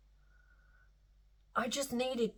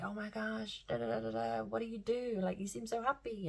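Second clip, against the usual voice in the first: soft, higher-pitched gushing imitation; imitated admirers; 4 semitones higher, 9 dB quieter than usual. People commenting on the speaker's energy, softly imitated admiration. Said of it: "people often comment on my energy and say".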